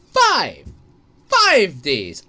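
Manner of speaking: five hit loud and repeated; stress on five. Real, not acted. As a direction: punch the word five. Stressing the number of days.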